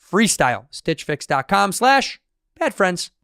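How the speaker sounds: upbeat sponsor read. Acted. Host reading the Stitch Fix ad, upbeat. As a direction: sell it like a host ad.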